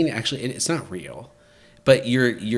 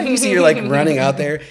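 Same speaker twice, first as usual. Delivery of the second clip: excited; 6 semitones higher, 10 dB louder than usual. Real, not acted. Excitedly describing the plunge.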